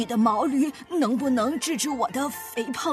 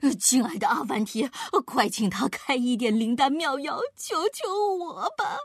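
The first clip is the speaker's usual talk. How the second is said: pitiful pleading. A narrator's reading. Fat man begs Afanti for a miracle drug, pitiful. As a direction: beg pathetically, wailing the last words. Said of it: "用可怜巴巴的声音哀求道".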